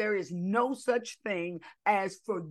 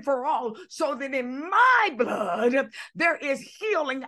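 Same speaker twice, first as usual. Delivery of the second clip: impassioned preaching; 2 semitones higher, 4 dB louder than usual. Real, not acted. Preacher telling a congregation why Jesus shed his blood, impassioned. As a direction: build each phrase toward a stretched, punched word. Preaches and voices God's words.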